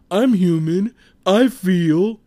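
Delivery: hammed-up high, loud wounded dignity; mock solemn brother. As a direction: play the tough guy insisting he has feelings. Brother's mock-solemn reply after crying.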